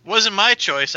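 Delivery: half-yelled parting retort; yelled over shoulder. Acted. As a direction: call back over your shoulder in protest. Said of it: "I yell over my shoulder".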